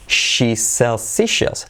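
"She's Celsius" drawn out, sh and s held apart; slow pronunciation model. Shown as a demonstration. Teacher working the phrase with learners, slow careful model. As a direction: stretch each sibilant very slowly. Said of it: "Vamos a trabajarla".

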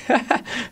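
high amused giggle; risa. Real, not acted. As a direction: laugh at your own impression. Amused by own impression. (risa aguda y divertida)

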